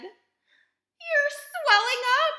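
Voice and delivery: slow, very high, loud scream; horrified mother's drawn-out scream. A narrator's reading. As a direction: scream it, stretching the words. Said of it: "screamed Missus Beauregard again".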